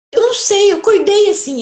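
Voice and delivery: irritated person's reply. Acted. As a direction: play the irritated one who doesn't know why. (resposta irritada, mais alta e aguda)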